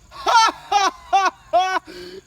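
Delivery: high, loud villain cackle; evil laugh. Acted. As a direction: gloat with a big laugh.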